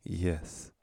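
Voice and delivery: soft, quiet one-word answer; subdued acted yes; hushed acted reply. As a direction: answer the dog quietly, as the hero. Repeats hero's reply to dog.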